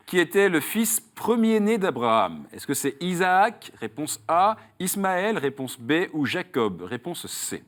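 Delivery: quiz question read. Acted. Host quizzes a contestant on Saul's road, quiz question read. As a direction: pose the question and lay out each choice.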